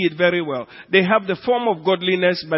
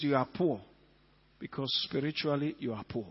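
quiet rebuke; 3 semitones lower, 10 dB quieter than usual. Acted. Jesus answering the boast.

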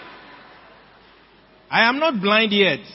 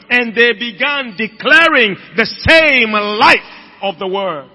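louder, slow, emphatic preaching; building sermon; 5 dB louder, slower than usual. Acted. Preacher stirring the congregation, rising loud. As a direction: build each line with more force.

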